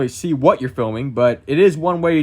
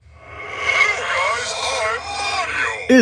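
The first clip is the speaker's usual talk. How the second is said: slow, high, unearthly ghost voice; 9 semitones higher, much slower than usual. Shown as a demonstration. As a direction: drag it out like a ghost. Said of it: "If you wanna sound like a ghost".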